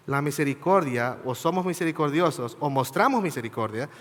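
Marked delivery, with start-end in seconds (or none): none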